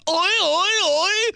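high, loud goofy "oi"; mock silly character. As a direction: ham it up. Silly put-on voice.

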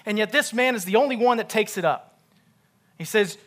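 loud, high, fast preacher delivery; full-force preaching. Real, not acted. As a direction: drive the point home loudly and quickly.

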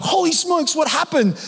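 high, alarmed exclamation; imitating a startled parent; shocked cry. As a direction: gasp it out in shock. Parents shocked at son's attack.

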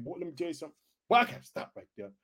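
usual speaking voice

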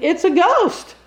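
hammed-up scared shout, pitched high; mock terrified scream. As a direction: play the frightened disciples shrieking. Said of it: "they start screaming".

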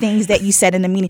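ordinary delivery

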